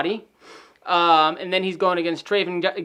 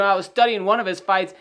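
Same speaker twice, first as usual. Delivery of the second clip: usual speaking voice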